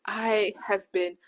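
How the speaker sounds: ordinary delivery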